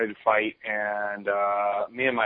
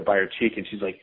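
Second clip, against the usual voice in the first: normal talking voice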